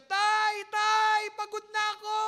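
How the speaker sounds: high, loud desperate shout; shouting for father. Acted. The prodigal son yelling for his father, loud and desperate. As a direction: call out to the father at full voice. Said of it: "sumigaw lang siya".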